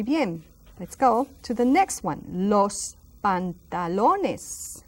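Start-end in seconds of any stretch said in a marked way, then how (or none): 2.3-4.8 Los pantalones, very slow and drawn out; slow model word. Shown as a demonstration. Teacher slowly modelling a long word: los pantalones. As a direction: spell it out slowly for learners. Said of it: "That's a very long one".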